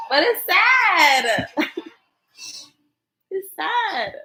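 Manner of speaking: high, drawn-out tearful whine; tearful protest. Real, not acted. Defending her crying to her husband, tearful high whine. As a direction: whine it through tears and a laugh. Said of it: "I've been crying the whole video".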